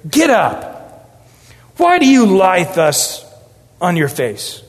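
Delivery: lightly voiced impatient God, slowing on the question; a hint of an exasperated father scolding; God tiring of Joshua's self-pity; frustrated God. Shown as a demonstration. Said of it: "with my inflections of my voice".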